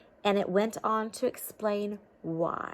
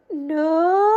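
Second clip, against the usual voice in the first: high rising no; 8 semitones higher, 10 dB louder than usual. Shown as a demonstration. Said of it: "Which way did we go?".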